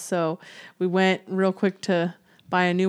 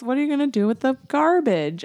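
ordinary talk

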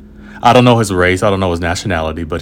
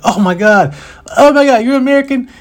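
much higher and louder, disbelieving gasp; starstruck kid; 5 dB louder than usual. Retold or imitated. Boy learns the traveler is American, starstruck squeal. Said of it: "totally blown away".

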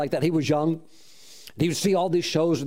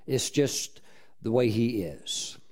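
slower and softer, lower, letting it land; slow soft repeat; 5 semitones lower, 5 dB quieter, slower than usual. Shown as a demonstration. Preacher to congregation, slow soft emphasis on God's ways. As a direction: repeat it slowly, dropping the voice.

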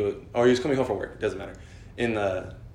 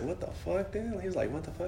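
high, soft retold disbelief; tripping out; 7 semitones higher, 9 dB quieter than usual. Son retells dad's shock, tripping out quietly. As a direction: imitate a stunned man under his breath. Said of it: "he was, like, tripping".

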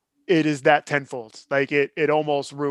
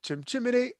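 Cockney accent; 8 semitones higher, 6 dB quieter than usual. Shown as a demonstration. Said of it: "I can't do it".

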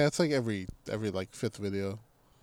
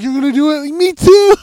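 excited squeal; 12 semitones higher, 9 dB louder than usual. Retold or imitated. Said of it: "I'm like".

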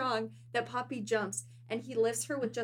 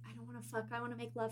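much softer, hushed imitation of an earnest ex; mock tender murmur; 2 semitones lower, 12 dB quieter, much faster than usual. Host imitating her ex-boyfriend during a fight, hushed mock murmur. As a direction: murmur it like a cheesy romantic plea. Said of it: "he's like".

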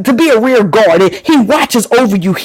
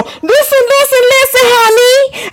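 much higher, sung-out listen, listen; high call; 13 semitones higher than usual. Acted. Preacher calling the congregation in a high sung voice. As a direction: lift the voice way up to call the crowd.

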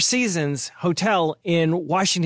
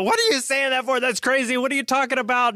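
higher, half-joking yell at the TV; mock yell at the TV; 7 semitones higher, faster than usual. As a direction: yell back at a TV pundit in jest. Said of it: "I'll be screaming at the T V and go".

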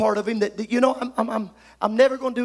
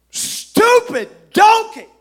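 angry yell; 11 semitones higher, 8 dB louder, much slower than usual. Acted. Balaam yells at donkey.

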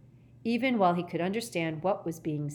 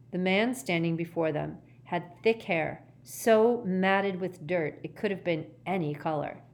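plain reading voice

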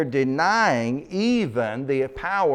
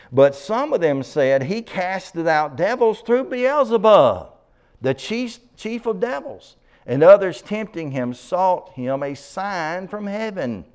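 high, raised-voice Bible declamation; emphatic scripture reading; 7 semitones higher, 5 dB louder than usual. Acted. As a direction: ring the verse out to the congregation. Reads scripture aloud.

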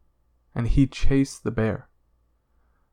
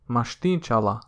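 usual speaking voice

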